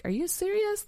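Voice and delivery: high-pitched disbelief, soft but sharp; incredulous. Retold or imitated. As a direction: shoot it back in disbelief. Incredulous reply to God.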